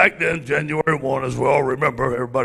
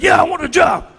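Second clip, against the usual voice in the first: high, loud shouted yes; excited shout; 14 semitones higher, 8 dB louder than usual. Retold or imitated. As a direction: yell it out, thrilled. Shouting acceptance of the job.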